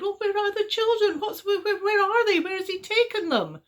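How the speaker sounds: high loud frantic questions; stumbling alarm; panicked parents. A narrator's reading. Parents searching for their children, frantic and high.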